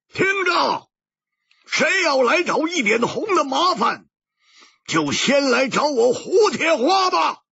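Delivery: high, full shout; wild roar. A narrator's reading. Hu Tiehua challenging the hidden swordsmen, roaring. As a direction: bellow a challenge into the night. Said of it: "狂吼着说".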